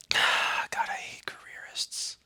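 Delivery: breathy whispered disgust. Acted. Muttering to himself about careerists, whispered. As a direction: hiss it under your breath like a confession.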